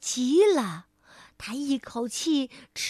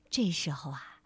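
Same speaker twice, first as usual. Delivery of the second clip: plain reading voice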